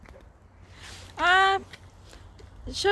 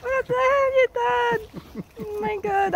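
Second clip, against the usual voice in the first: shocked; 7 semitones higher than usual. Real, not acted. To the one who put snow on her, shocked question. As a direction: demand it in disbelief. Shocked at what was done.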